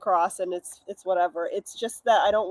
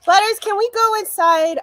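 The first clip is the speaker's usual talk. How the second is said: calling the dog; 11 semitones higher, 6 dB louder than usual. Real, not acted.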